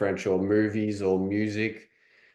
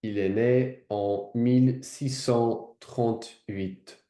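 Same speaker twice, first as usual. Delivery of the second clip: slow, measured French accent; French repeat-after-audio; 3 semitones higher, slower than usual. Shown as a demonstration. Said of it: "that's how you can do it".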